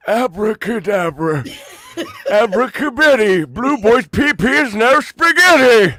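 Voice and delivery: hammed-up old wizard incantation; Joe Biden voice. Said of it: "I'm gonna do Dan's Joe Biden impression".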